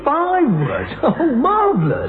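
sneering high praise; mock delight. Mock praise for a begging captive.

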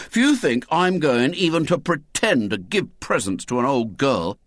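indignant schoolboy refusal; William, sternly. A narrator's reading. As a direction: refuse with scornful emphasis. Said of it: "said William sternly".

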